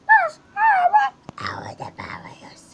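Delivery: high breathy whispered character line. Pretend. The toy store character whispers its proposal to the kid.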